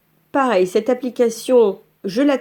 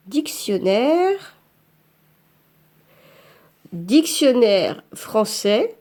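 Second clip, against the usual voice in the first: dictée lente en tapant; much slower than usual. Shown as a demonstration. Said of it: "le dictionnaire gratuit".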